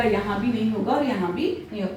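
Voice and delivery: ordinary talk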